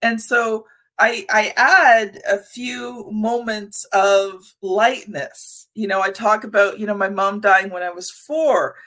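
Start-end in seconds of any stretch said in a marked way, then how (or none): none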